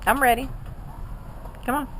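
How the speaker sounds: usual speaking voice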